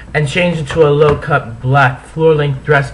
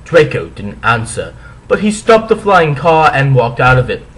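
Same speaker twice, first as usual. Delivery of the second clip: ordinary delivery, nothing marked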